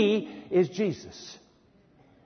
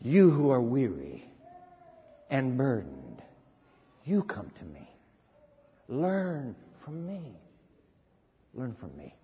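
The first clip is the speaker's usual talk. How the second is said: soft, lower, gentle invitation; hushed, lower tender call of Jesus; 8 semitones lower, 6 dB quieter than usual. Retold or imitated. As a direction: coax the weary in close and quiet. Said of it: "he said".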